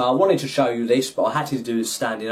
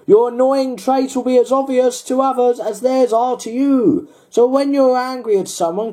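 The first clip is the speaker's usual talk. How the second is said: Darren Brown fortune teller; 10 semitones higher, 4 dB louder than usual. Retold or imitated. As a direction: read it like a spooky fortune machine. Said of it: "good old Darren Brown fortune teller voice there".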